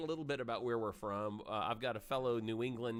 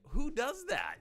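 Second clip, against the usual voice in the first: baffled; 12 semitones higher, 6 dB louder than usual. Retold or imitated. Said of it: "you're like".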